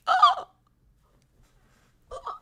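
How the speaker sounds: very high, loud "Oh!"; posed shock. Acted. Streamer fakes a shocked face for thumbnails. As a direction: gasp it out for the camera. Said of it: "scream more emotions at me".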